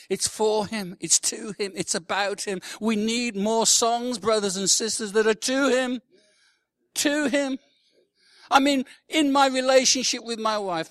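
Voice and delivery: high, loud, urgent preaching; impassioned preaching. Real, not acted. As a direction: drive it home to the congregation. Preaches at full force.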